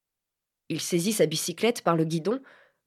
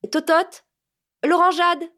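much higher, louder call to the maid; 10 semitones higher, 6 dB louder than usual. Acted. Madame Dalré calls loudly to her maid Totote. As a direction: call across the house for service. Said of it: "cria madame Dalré".

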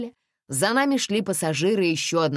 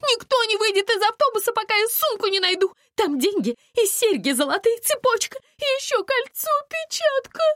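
high, frantic demanding; hysterical wailing; 4 dB louder than usual. A narrator's reading. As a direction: rant in panic, pitched way up. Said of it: "завопила Настя".

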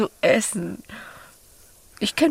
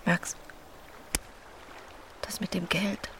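tender whispered confession; 4 semitones lower, 13 dB quieter than usual. Acted. Emma confides her loneliness to Max, whispering. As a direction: breathe it close to his ear.

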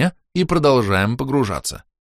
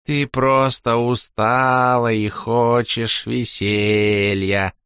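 slow soothing; 3 semitones higher, much slower than usual. A narrator's reading. Slow soothing character line.

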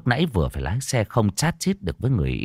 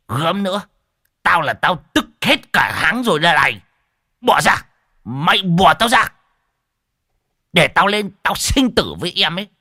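put-on higher, louder, eager old-man shouting; lusty excited old man; 10 semitones higher, 5 dB louder than usual. Old man struggling to rush the stage, high loud eager shout. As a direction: pull free and charge in, greedy.

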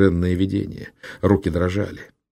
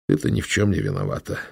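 put-on tender to child; 10 semitones lower, faster than usual. Uncle speaks to crying baby.

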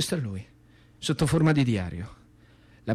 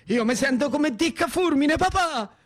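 put-on very high, eager boyish outpouring; excited child; 12 semitones higher than usual. Son telling papà about comic heroes, very high excited. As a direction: pour it out high like a thrilled kid. Boy proclaiming heroes to father.

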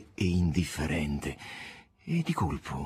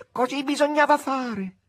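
higher, distant echo of the chorus; echoing retinue; 3 dB louder than usual. Acted. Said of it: "ripeté come un eco il seguito". (eco del seguito, più acuto e lontano)

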